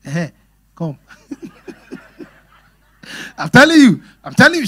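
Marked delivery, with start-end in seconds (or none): 3.4-4.7 much louder and higher, pressing the point home; emphatic. Real, not acted. Preacher insisting to the congregation, loud and high.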